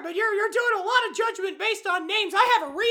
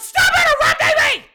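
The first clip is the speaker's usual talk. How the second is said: sudden loud, higher shout; angry outburst; 5 semitones higher, 6 dB louder than usual. Acted. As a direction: shout down the interrupter. Snaps at interruption while reading note.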